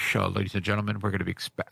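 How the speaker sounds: lightly voiced low pilot announcement; a hint of cockpit intercom patter, low. Pilot addressing passengers on a flight, lightly imitated announcement. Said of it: "pilot, he was saying".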